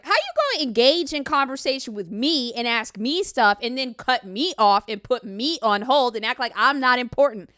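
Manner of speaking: loud, indignant mock rant; hammed-up offended outburst; mock outrage. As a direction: play the insulted one, loudly. Mock offended rant, set up as a bit.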